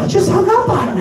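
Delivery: high, loud, fast disbelief; stunned. Retold or imitated. John stunned after the dad hangs up on him. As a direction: rush out the shock. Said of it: "John's like".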